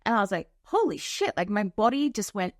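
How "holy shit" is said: much higher, startled gasp of words; shocked exclamation. Retold or imitated. Remembering the moment she saw the curser, high shocked blurt.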